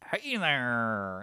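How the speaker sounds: put-on a cat with a kicked-in throat meowing like an old-timey man; old-timey guy meow. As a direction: croak it out like an old-timey fellow saying hey. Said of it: "That's what his throat sounded like".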